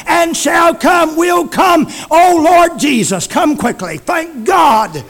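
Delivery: loud, climbing revival preaching; preaching at full force. Real, not acted. Preacher exalting Jesus to the church, shouting. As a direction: drive it up to a shout.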